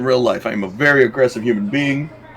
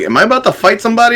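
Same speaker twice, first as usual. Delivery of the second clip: quick, higher alarmed question; 9 semitones higher, faster than usual. Retold or imitated. Gamer retelling a stranger's greeting, quick and alarmed. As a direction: rush it out, bracing for a fight. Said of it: "I was like".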